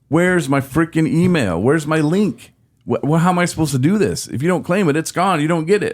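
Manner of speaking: high-pitched indignant outburst; mad retelling. Retold or imitated. Retelling panic over a missing email link, mad. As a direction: demand answers in a worked-up, high voice. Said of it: "I was so mad".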